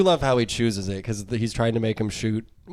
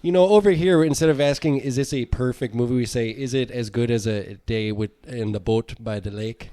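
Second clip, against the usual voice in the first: put-on Canadian accent. Bottle explaining Canadian custom in mock Canadian accent. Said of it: "in a Canadian accent".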